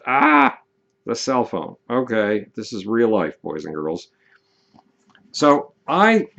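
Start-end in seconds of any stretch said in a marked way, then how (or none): 0.0-0.6 sudden high, loud "ah"; exasperated yelp. Real, not acted. Speaker interrupted by a cell phone, exasperated yelp. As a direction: yelp out at a much higher pitch.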